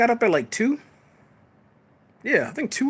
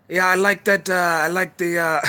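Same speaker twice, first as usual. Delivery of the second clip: a hammed-up fake Wakandan accent, higher and louder; mock the villain in a terrible Wakandan accent; bad Wakandan accent; 5 semitones higher, 5 dB louder than usual. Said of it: "it was like a Wakandan accent".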